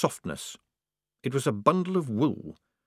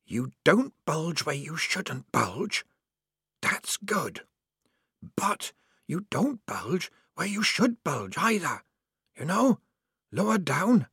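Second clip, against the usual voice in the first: higher, slow, hushed instruction; patient hoarse whisper; 7 semitones higher, slower than usual. A narrator's reading. Mystery helper explaining socks to Polly, patient whisper. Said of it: "said the whisperer patiently".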